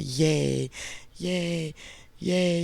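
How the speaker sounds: high cheer without screaming; restrained cheer. Shown as a demonstration. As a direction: cheer brightly, holding back the scream. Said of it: "I can't really scream like I wanna scream".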